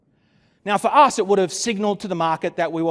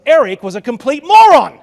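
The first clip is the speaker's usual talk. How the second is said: hammed-up shout, much louder and higher; mock outburst; 8 semitones higher, 15 dB louder than usual. Speaker jokingly blaming partner for lost deal, mock shout. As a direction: build to a joking yell on the last word.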